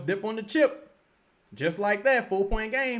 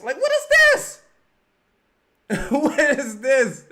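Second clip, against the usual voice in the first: high, loud cry of disbelief, then slower; disbelieving shout; 8 semitones higher, 9 dB louder, slower than usual. Real, not acted. Streamer yelling at the team on TV, high loud disbelief. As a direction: shout it like you can't believe your eyes.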